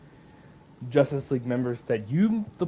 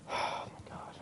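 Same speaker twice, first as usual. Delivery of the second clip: whispered disbelief under the breath; stunned whisper; 15 dB quieter than usual. Real, not acted. Streamer reeling from Rocksteady's game, whispering. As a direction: let it out in a hushed whisper. Stunned before starting the rant.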